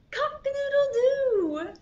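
high, loud playful crow; rooster crow. A narrator's reading. Storyteller voicing the rooster, high crowing call. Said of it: "says the rooster".

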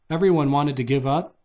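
usual speaking voice

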